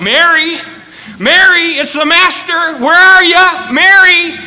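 high, loud searching shout; Jesus calling out. Acted. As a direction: holler for someone across the yard. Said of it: "and Jesus called out".

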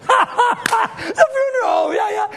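high, drawn-out laughing shout; laughing mock shout. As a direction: belt it out cracking up at the joke. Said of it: "They laughed".

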